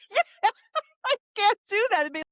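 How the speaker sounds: high, loud laugh through the words; real high-pitched amused laughter in the voice. Real, not acted. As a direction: talk while cracking up. Laughs at the dumbass joke.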